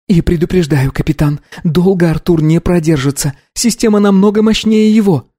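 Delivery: high female character voice; focused young woman. Acted. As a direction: report intently in a high voice.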